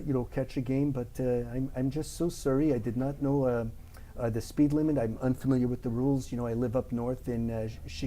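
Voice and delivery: polite, hammed-up Canadian accent. Driver sweet-talks officer after speeding, thick Canadian accent. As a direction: apologize your way out in friendly Canadian. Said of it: "speak like a Canadian".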